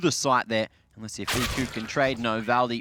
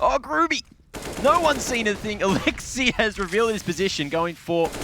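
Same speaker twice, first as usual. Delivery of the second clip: high, raised-pitch burst on the action; excited play call; 8 semitones higher than usual. Real, not acted. Caster jumps on Groovy's surprise play, excited. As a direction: call the kill as it erupts.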